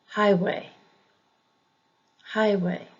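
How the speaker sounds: highway with HIGH stressed; first-syllable stress. Shown as a demonstration. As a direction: say highway as HIGH-way, second part lighter. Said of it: "emphasis on the first syllable".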